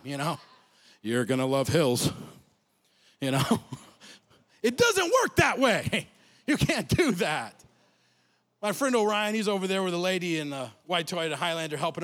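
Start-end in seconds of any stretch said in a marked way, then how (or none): 1.0-3.4 deadpan joke. Retold or imitated. Mock cheerful line to lady. 4.6-7.5 slow, laughing disbelief. Real, not acted. Laughing aside after joke.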